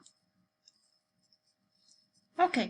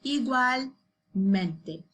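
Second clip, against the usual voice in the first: "Igualmente" said very slowly, tongue touching the roof for the L; drawn-out model "Igualmente" for repeating; slow model word; much slower than usual. Shown as a demonstration. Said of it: "the tip of your tongue to pronounce L".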